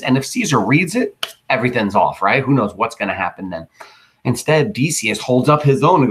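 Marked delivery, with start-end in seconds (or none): none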